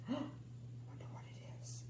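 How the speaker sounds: hushed breathy aside after a gasp; whisper. Real, not acted. Reader hushes to the child in suspense. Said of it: "gasp then whispered aside".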